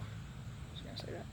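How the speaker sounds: breathy whisper to himself; whispered aside. Real, not acted. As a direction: murmur it off-mic.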